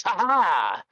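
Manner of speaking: loud, high showman's "ha ha"; hammy dwarf cackle; dwarf's laugh. Acted. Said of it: "the dwarf goes".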